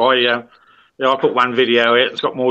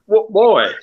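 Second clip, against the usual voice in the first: higher-pitched indignant questioning, quick and put-on; mock complaining viewers; 7 semitones higher than usual. Channel viewers reacting to something different, mock indignant. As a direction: imitate baffled viewers demanding an explanation. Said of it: "and they instantly".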